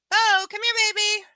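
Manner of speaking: loud, high call; calling the dog. Real, not acted. Owner calling sleepy dog from couch, high and loud.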